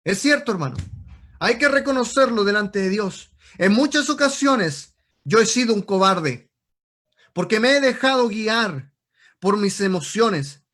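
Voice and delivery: loud, forceful exhortation; urgent, ringing pulpit call; fiery preaching. Real, not acted. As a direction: preach it at full force to the congregation. Preaches confession at full force.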